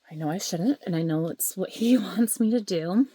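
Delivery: ordinary talk, nothing marked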